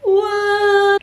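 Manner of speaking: high, loud mock outrage; mock surprise. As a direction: squeal it in fake shock. Mock shock at news.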